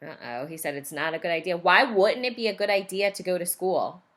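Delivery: plain reading voice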